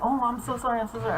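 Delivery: flustered apology. Acted. Ghost apologizes for scaring.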